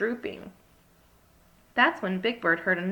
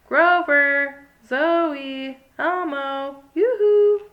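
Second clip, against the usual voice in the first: loud high sing-song calling; hide-and-seek call; calling out names; 5 semitones higher, 8 dB louder, much slower than usual. A narrator's reading. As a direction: sing out each friend's name to find them. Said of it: "Big Bird called".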